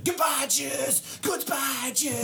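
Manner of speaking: put-on high, slow, dramatic child voice; in character. Child actress snapping into her character. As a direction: land it fully in character. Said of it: "right in the character".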